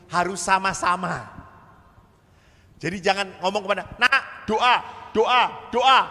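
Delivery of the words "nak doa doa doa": loud, high barking order; nagging shout. Retold or imitated. Parent yelling at the child to pray, loud and bossy.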